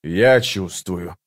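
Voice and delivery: slow, drawn-out growl; menacing, slow growl in an altered voice; growled reply. A narrator's reading. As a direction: growl it slowly through the words. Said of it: "прорычал Паран".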